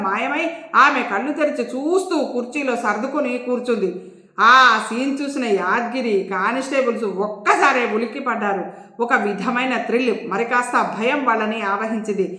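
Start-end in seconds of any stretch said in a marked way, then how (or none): none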